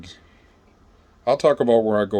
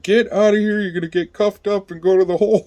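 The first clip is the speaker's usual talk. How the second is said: high, louder mock guard threat; guard threatening; 11 semitones higher, 5 dB louder than usual. Guard threatens the inmate with the hole, high mock shout. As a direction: warn him he's headed for the hole.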